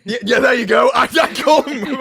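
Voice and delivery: loud and high, laughing through it; laughing shout. Real, not acted. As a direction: burst out laughing loudly. Laughing over a mocked accent.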